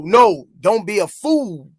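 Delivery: ordinary talk, nothing marked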